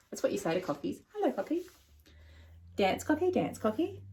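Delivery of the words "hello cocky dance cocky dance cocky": sing-song to a bird. Acted. Teaching parrot words.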